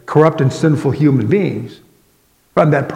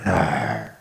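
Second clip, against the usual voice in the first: low growling groan of despair; frustrated groan; 11 semitones lower, 7 dB quieter than usual. Pretend. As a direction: groan like you're at the end of your rope. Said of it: "shaking your head and going".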